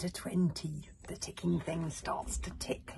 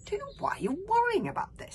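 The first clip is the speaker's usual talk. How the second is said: Fishlegs voice; 8 dB louder than usual. A narrator's reading.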